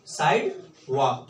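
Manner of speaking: "Side वॉक" said slowly, each part drawn out; slow repeat. Shown as a demonstration. Teacher to class, drilling the American word slowly. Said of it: "क्या बोलेंगे".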